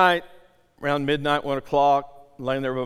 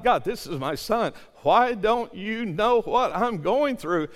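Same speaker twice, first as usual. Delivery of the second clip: raised, drawn-out pleading protest; debating with God; 8 semitones higher, slower than usual. Retold or imitated. Father debating with God over his son, pleading. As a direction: plead the case slowly and insistently. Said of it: "I'm debating with God".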